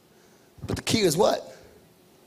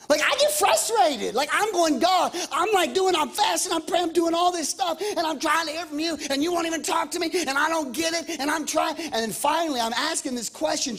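pitched up, exasperated rant; frustrated; 7 semitones higher than usual. Retold or imitated. Pastor retelling his frustrated prayers to God. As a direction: pour out the frustration, higher.

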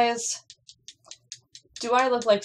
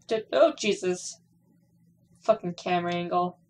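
usual speaking voice

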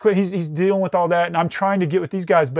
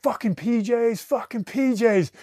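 retold crying cry; 5 semitones higher than usual. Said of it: "he grabs me and he's crying".

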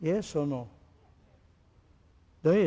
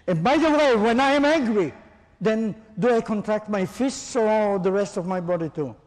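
loud, high angry outburst to show tension; angry demo; 5 semitones higher, 9 dB louder than usual. Shown as a demonstration. Teacher shows students an angry voice, loud and high. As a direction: belt it out like you're furious. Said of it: "Can you see my voice? Everything gets angry".

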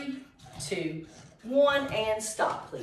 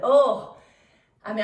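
ordinary delivery

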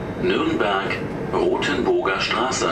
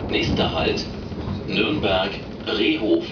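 over the intercom. Real, not acted. Recorded train stop announcements.

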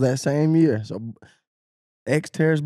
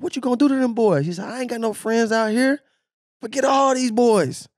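hype trash talk; 9 semitones higher than usual. Retold or imitated.

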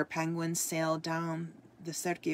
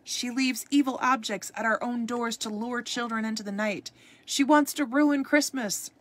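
high, put-on mob voice building louder; villager's raised, alarmed outcry; howling accusation; 6 semitones higher, 6 dB louder than usual. A narrator's reading. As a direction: accuse the witch, rising to a loud cry. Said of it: "they howled".

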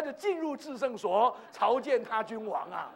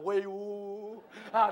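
lightly mimicked long ceremonial call; imitated angels' cry; much slower than usual. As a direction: draw it out like an honour guard. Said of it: "马上天使都要喊威武啊". (模仿天使拖长声音喊威武)

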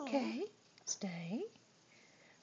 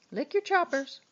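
much higher pet-talk sing-song; 9 semitones higher than usual. Real, not acted. Owner coaxing her dog after a treat, high sing-song. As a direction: lift it up high and bright for the dog.

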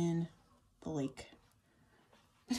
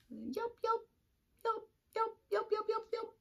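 high bright puppy yips; yipping puppy. Acted. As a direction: yip like a small pup.

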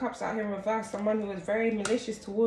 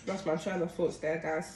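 ordinary talk, nothing marked

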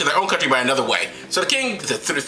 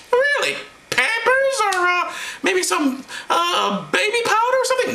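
high falsetto, hopeful questions; high-pitched comic mother; comic Mary; 13 semitones higher than usual. Acted. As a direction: put on a very high woman's voice. Acting Mary asking about gifts.

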